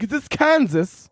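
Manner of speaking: put-on high, slow girlish Dorothy; lost girl asking where she is. Comic asking if this is Kansas, high and slow.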